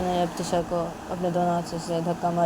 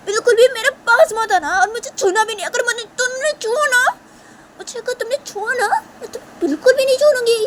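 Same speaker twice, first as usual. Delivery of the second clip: shrieking high and loud in outrage; outraged shrieking; furious screaming; 10 dB louder than usual. Acted. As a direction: scream every word at her, climbing higher. Shanaya threatens Tisha after pushing her.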